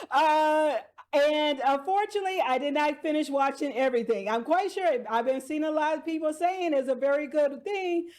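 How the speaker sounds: usual speaking voice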